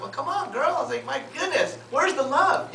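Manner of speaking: high, teasing mock complaint; playful reproach. Agent to reluctant client about referrals, playful reproach.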